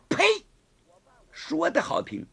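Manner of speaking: high, mocking scoff; sneering. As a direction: sneer at his fine words. Woman scoffs at Gao Feng.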